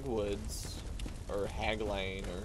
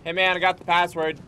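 louder, higher friendly hail; mock call out; 7 semitones higher, 9 dB louder than usual. Gamer rehearsing his greeting to the character, calling out. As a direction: hail him cheerfully. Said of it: "Just be like".

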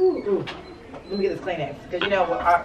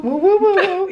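loud playful woo; 12 semitones higher, 7 dB louder than usual. Real, not acted. Echoing friend's woo.

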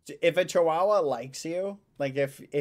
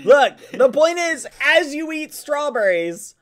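exasperated; 11 semitones higher, 9 dB louder than usual. Real, not acted. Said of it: "exasperated explaining".

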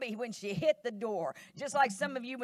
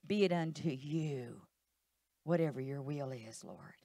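surrendered prayer; 6 semitones lower, 3 dB quieter than usual. Acted.

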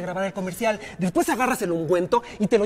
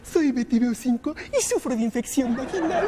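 a hint of mocking imitation of the actress, with a laugh; mocking Betty; 2 semitones higher, 3 dB quieter than usual. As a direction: mimic her line to mock her. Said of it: "me estás arremedando". (el director arremeda a Betty burlándose)

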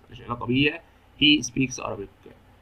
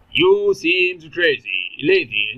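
drawn out very slowly and a bit louder; slow, loud reading; 3 semitones higher, 6 dB louder, much slower than usual. Shown as a demonstration. Teacher reading an exam sentence to his students, slow and loud. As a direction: read the sentence out slowly and clearly to the class.

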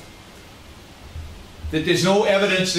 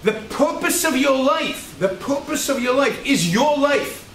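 ordinary talk

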